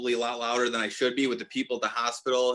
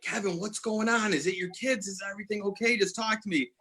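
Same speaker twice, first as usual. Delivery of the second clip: higher, urgent worried questions; panicked pleading; 8 semitones higher than usual. Retold or imitated. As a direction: beg him to talk, scared for him. Panicked call to brother.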